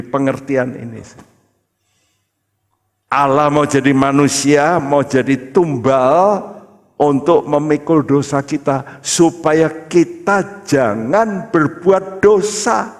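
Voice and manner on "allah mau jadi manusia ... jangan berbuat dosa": high, rising preacher's voice; emphatic preaching. Real, not acted. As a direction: drive each phrase home, building higher and slower at the end. Preaches at full force. (khotbah berapi-api dengan nada meninggi)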